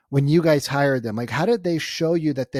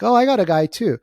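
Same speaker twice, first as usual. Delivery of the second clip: quoting the husband; 7 semitones higher, 6 dB louder than usual. Retold or imitated. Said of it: "He's like".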